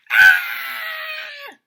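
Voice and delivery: very high loud shriek; lady's scream. Acted. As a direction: let out a piercing lady's scream. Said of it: "It was a lady's scream".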